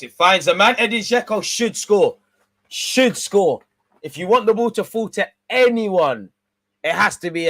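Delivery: loud and high, calling the chance; excited commentary. Real, not acted. Fan calling a big chance, loud and excited. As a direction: rise with the attack.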